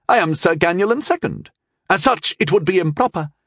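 high, indignant knightly voice; objecting knight. A narrator's reading. Knight refusing the duel as second, high indignant objection.

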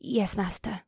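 soft, sighed concession; resigned, hushed reply; sighing. Acted. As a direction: give in quietly after a sigh.

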